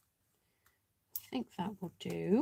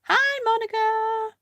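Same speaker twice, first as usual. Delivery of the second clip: much higher and louder, a slow sing-song greeting; high-pitched hello; 7 dB louder, slower than usual. Pretend. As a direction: chirp a cheery hello up high. Greeting a viewer by name.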